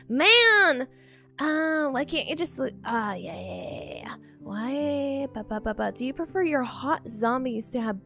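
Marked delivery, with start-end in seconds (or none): none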